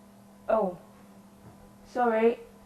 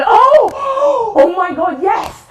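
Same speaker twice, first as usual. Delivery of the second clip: sudden high excited shout; a burst of delighted surprise; an idea hits and you cry out loud; surprised cry; 10 semitones higher, 5 dB louder, much slower than usual. Real, not acted. Suddenly sees a game idea.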